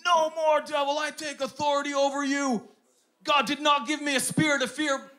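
shouted high and loud, defiant; angry declaration. Retold or imitated. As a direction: shout the devil down with authority. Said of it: "I just stomped my foot".

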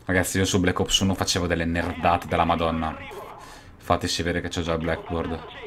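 ordinary delivery

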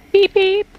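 very high, loud beeps; imitated bus horn. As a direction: squeal out a pretend horn honk.

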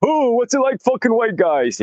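high, far-off mock of a pushy vlogger. Mocking vloggers who shove cameras at prostitutes, in a high put-on voice. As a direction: lampoon the camera-in-your-face guy. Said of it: "The guys who walk around".

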